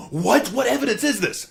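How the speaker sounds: high, loud startled outburst; shocked disbelief. Acted. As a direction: blurt it out, stunned. Courtroom reacts to accusation.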